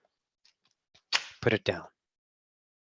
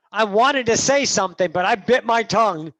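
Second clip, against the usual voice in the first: loud, high imitation of complaining; mock indignant quote; 5 semitones higher, 8 dB louder than usual. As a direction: mimic someone loudly airing a grievance. Acted complaint quoted from the audience.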